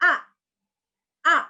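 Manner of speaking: "A" short, loud, dropping from high; stamped falling tone. Shown as a demonstration. Teacher demonstrating tone four, abrupt falling tone. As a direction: punch out a brief "A" downward.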